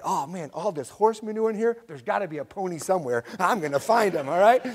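put-on a boy thrilled to hunt for a pony; excited boy. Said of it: "And he said".

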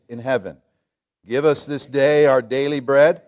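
loud, measured King James recital; solemn scripture reading. Shown as a demonstration. Pastor leads the congregation reading the Lord's Prayer, solemn and loud. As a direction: read it out with full manly weight. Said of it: "with that manly voice".

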